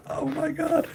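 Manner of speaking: very high, laughing disbelief. Real, not acted. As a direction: gasp it out mid-laugh. Still laughing, shocked.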